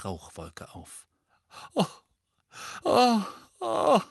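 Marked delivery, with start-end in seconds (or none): 1.7-4.0 high, loud wailing oh. A narrator's reading. Mrs Weasley wailing after the boggart vanishes. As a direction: wail it in distress. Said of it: "jammerte Misses Weasley".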